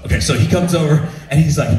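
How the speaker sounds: normal talking voice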